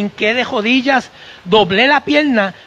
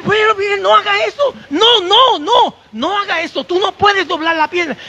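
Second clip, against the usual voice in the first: panicked doctor; 9 semitones higher than usual. Retold or imitated. Doctor still panicking at patient.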